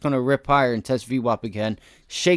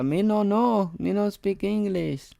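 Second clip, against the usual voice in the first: high, hammed-up mock Spanish accent; 7 semitones higher than usual. Retelling a traffic stop joke with coworker, high mock Spanish accent. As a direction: play the coworker dodging the cop in a fake Spanish accent. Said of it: "He would talk like Spanish".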